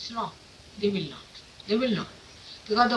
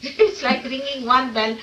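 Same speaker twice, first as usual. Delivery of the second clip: ordinary talk, nothing marked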